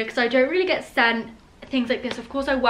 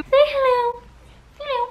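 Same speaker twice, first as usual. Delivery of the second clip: much higher, sing-song pet voice; high cooing voice for a pet; 13 semitones higher than usual. Real, not acted. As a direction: coo at the dog to say hello. Coaxing the dog Lexi to greet.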